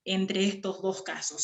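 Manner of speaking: ordinary delivery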